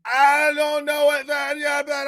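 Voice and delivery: imitating a high, panicked wail; scared shitless. Caught teenager facing the furious boyfriend, mock panicked wail. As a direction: stretch it into a high, frightened babble. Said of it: "I was scared shitless of the guy".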